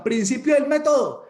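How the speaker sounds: high, loud mock yell; hammed-up public holler; acted street shout. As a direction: call it out down the street.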